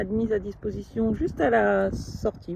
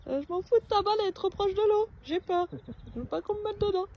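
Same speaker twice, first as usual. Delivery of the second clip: voicing the dog; 8 semitones higher, faster than usual. Voicing scared dog Oreo.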